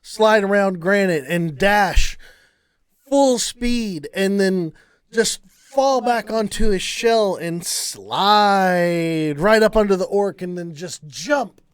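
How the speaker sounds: excited, punchy blow-by-blow; animated action narration. A narrator's reading. Game master tells players of the killing blow, animated narration. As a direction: stretch the action words with relish.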